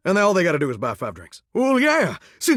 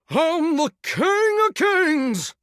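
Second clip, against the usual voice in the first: high, slow, stretched-out proclamation; hammy showman's drawn-out brag; grand boast; 10 semitones higher, much slower than usual. Acted. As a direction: crow it like a stage idol. Boasts as show starts.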